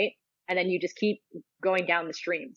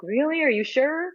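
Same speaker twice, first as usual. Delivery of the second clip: high, louder incredulous questioning; mock doubting; 9 semitones higher, 5 dB louder, slower than usual. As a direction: play someone questioning the choice, pitched up. Acts out someone questioning a decision.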